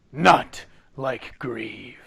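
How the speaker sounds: slow, emphatic NOT, then dropping away; angry reply. Acted. Game master as Jack answering a question about Grieve, angrily. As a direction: punch the first word, drag out the rest in understated anger. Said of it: "angrily replies".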